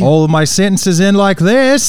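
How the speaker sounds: loud, high, slow Australian parody rising at the end; Australian uptalk. Shown as a demonstration. Co-host mocking how Australians end sentences, exaggerated Australian uptalk. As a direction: lift the end like a question, mock Australian. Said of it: "it's a question".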